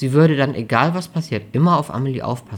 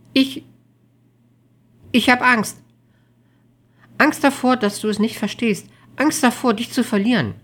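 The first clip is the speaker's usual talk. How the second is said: high, anxious plea on the verge of tears; frightened, raised-pitch confession; tearful fear; 12 semitones higher, 4 dB louder than usual. A narrator's reading. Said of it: "ihr schossen die Tränen in die Augen".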